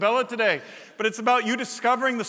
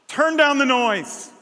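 emphatic; 4 semitones higher, 5 dB louder than usual. Real, not acted. Preacher's emphatic command.

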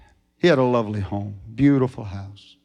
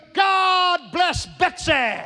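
very high, drawn-out holler; loud shout; much slower than usual. Acted. Man leaping on the cow's back, loud shout. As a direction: stretch each word into a jubilant pulpit shout. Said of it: "shouted as loud as he could".

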